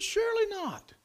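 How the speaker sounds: very high, incredulous cry; high-pitched disbelief. Retold or imitated. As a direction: pitch it right up, refusing to believe it. Retold disbelief at failed fence.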